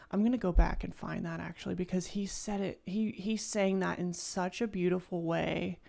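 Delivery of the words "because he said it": normal talking voice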